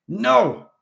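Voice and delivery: loud high yell. A narrator's reading. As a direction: cut him off with a yell. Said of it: "Gannon Haas yelled".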